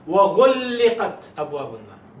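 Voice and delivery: وَغُلِّقَتْ hit loud, the doubled ل pressed hard; emphatic Arabic recitation. Shown as a demonstration. Teacher reciting loudly so students hear the emphasis. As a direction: slam the word وَغُلِّقَتْ with its shadda. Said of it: "Did you guys hear it?".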